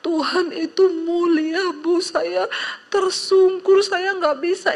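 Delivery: high, wailing retold sob; imitated tearful voice. Weeping convert telling her pastor of God, imitated high cry. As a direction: act out someone overcome and crying. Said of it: "Dia nangis, dia bilang".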